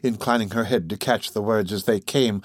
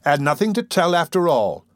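higher-pitched, offhand dismissal; careless, dismissive; 6 semitones higher than usual. Acted. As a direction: toss it off as of no consequence. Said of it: "walking carelessly away".

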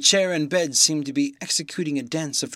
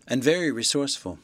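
usual speaking voice

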